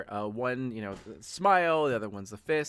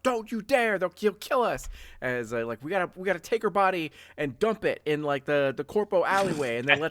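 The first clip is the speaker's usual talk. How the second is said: high, loud frantic orders; frantic angry daughters; 6 semitones higher, 6 dB louder than usual. Acted. Daughters forbidding the call to their father, furious. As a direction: hiss it out, furious and scared. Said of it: "the daughters are like".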